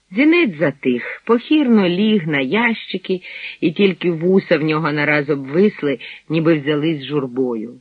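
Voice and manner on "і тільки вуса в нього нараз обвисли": usual speaking voice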